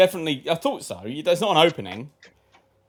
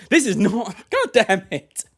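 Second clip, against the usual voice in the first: laughing exasperation; 6 semitones higher, 4 dB louder, slower than usual. Real, not acted. Plan ruined, laughing.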